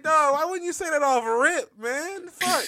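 high-pitched mock-exasperated whine; exasperated plea. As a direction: retell it as an exasperated complaint, voice pushed up. Exasperated reaction retold after laughing.

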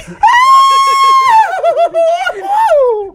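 long, very high, loud imitation howl; mock random yelling. Host imitating Josh's overheard yelling, mock howl. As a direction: yell out like a neighbour overheard through walls. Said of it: "We hearing random yelling and shit".

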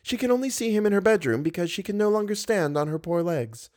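a lightly voiced high servant. A narrator's reading. Servant tells Raoul why she stays in bed, lightly voiced. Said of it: "said the servant".

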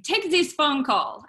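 imitated French chef giving orders; put-on French accent, insistent; bad French accent. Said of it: "sorry for my French accent".

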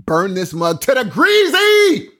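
high, loud, drawn-out shout; hammed-up yell. Ceremonial match lighter yelling to burn the plantation, hammed-up shout. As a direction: shout it slowly and high, stretching the last word.

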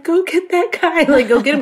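high, urgent call to a friend, half laughing; retelling a frantic cry to a friend filming from far away; urgent tearful plea. Retold or imitated. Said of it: "I'm, like, crying".

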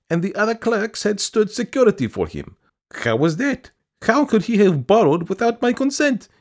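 high, pleading woman's voice; pleading petitioner. A narrator's reading. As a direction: beg in a high, fussy voice. Petitioner complaining to the official.

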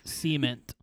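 "cement" as SEE-ment; "cement" pronounced SEE-ment, like the Clampetts; hillbilly pronunciation. Shown as a demonstration. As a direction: say "cement" stressed on the first syllable. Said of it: "The Beverly Hillbillies".

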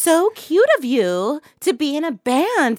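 high, sugary mock cop voice; mocking a cop charmed by the band; gushing cop. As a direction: coo it like a smitten fan. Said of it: "They're like".

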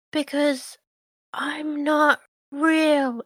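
lightly voiced bewildered sister; slow high sister voice.

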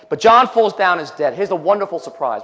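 usual speaking voice

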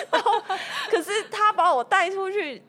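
laughing. Real, not acted. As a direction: still laughing as she speaks.

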